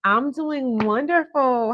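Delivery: high, slow, sing-song cheer; cheerful. Real, not acted. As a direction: sound delighted. Cheerful reply to caller.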